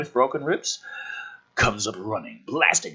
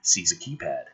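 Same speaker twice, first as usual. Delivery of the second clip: ordinary talk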